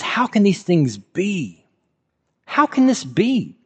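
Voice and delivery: slow, rising to a high, alarmed plea; Nicodemus, stunned and panicking, asks Jesus how; panicked Nicodemus. Acted. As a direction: act out his panic in disbelief. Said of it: "Nicodemus is panicked here".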